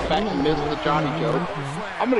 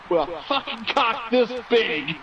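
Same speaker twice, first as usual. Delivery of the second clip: slow, drawn-out mock shout from a cheeky kid; higher, slowed kid yell; 5 semitones higher, slower than usual. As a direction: punch the punchline as a bratty boy yelling. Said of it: "and yells out".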